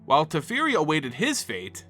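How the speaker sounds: ordinary delivery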